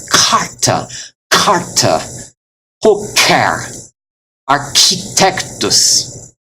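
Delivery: carta, poker, architectus with breathy bursts on the k; aspirated consonants. Shown as a demonstration. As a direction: overdo the puff after k in carta, poker, architectus. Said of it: "hear the breath coming out".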